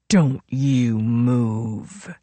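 low, very slow threat; low warning. A narrator's reading. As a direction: drag out the warning. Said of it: "he warned".

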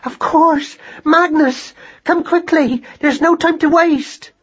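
very high-pitched urgent calling; frantic mother calling for help; urgent cry. A narrator's reading. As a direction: summon your son in a high, excited panic. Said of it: "she cried".

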